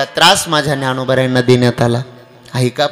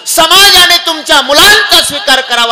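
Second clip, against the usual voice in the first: high, loud stern terms laid down; lecturing council; 13 semitones higher, 6 dB louder than usual. Acted. Alandi council laying down terms to Vitthalpant, loud stern lecture. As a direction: dictate conditions to Vitthalpant. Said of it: "धर्मसभेने सांगितलं".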